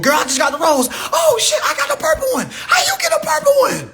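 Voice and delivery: put-on high, loud squealing girlfriends; women gushing. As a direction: shriek it like girls comparing toys. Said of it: "But let a woman do it".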